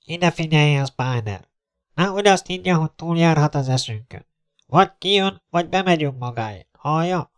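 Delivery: high-pitched, insistent ultimatum by loudspeaker; threatening captain. Acted. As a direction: warn him off and demand an answer. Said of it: "már a százados kezében volt". (fenyegetően felszólító százados)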